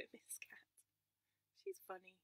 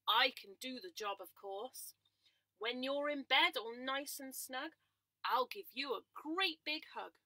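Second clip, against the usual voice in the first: louder, confident horse voice; hearty horse; 3 semitones higher, 10 dB louder, slower than usual. Acted. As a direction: boast like a big friendly horse. Missus Horse promises hug.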